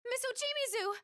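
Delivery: very high, urgent call. Acted. Friend calling to Miss Ochimizu, urgent high cry. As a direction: cry her name out at high pitch.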